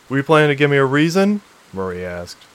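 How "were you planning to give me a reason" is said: raised, quick, louder challenge; sharp challenge. Acted. Pilot answering the captive tech, sharp challenge. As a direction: fire the question back, higher and fast.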